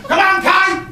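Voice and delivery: high, hammed-up coach cheering; mock PE teacher shouting. Teacher shouting at the batter, hammed-up yell.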